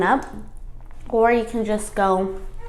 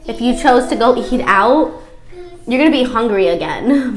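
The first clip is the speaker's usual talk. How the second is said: ordinary talk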